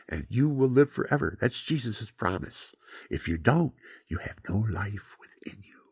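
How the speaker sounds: rising and falling sermon questions, some lines whispered; preaching. Acted. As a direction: preach the choice between light and darkness. Preaching to listeners.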